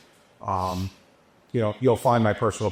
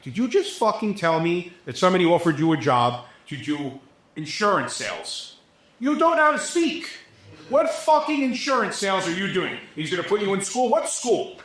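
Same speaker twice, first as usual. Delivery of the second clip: retold heated tirade, pitched higher and sharper; aggravated rant; 8 semitones higher than usual. Retelling how he scolded a young crew kid, heated and exasperated. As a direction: chew the kid out again, exasperated. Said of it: "I'm getting aggravated talking to this kid".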